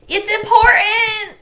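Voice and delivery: high, loud, slow yell with a cry in it; desperate yell. A narrator's reading. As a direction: yell it almost in tears. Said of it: "she yelled at him".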